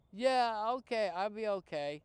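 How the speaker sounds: put-on high, loud kid voice; a boy telling his counselor he'll be fine; boy's answer. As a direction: reassure like an eager boy.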